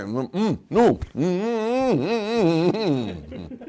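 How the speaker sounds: high, pleading closed-mouth hums; urgent muffled humming. Retold or imitated. Man mid-blessing answers the gunman in muffled, pleading hums.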